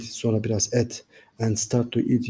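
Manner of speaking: usual speaking voice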